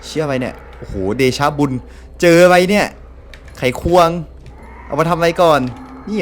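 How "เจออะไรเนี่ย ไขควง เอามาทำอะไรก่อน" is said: excited loud. Real, not acted. Excited find in game.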